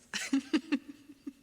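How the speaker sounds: high, loud giggles trailing away; giggling. Real, not acted. Streamer joking that thighs bring world peace, giggling. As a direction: giggle at your own joke. Jokes about offering her thighs.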